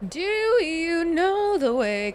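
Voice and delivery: silly drawn-out chant; mock meme voice. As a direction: drag it out like a meme.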